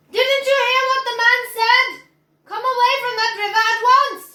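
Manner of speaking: high, loud scolding; scolding mother. Acted. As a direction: order him away from the river. Mother scolding her son.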